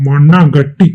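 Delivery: scornful, irritated dismissal; curt burst of temper; angry retort. Acted. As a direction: cut the hopeful question dead. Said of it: "ஏன் இவ்ளோ கோவப்படுறீங்க".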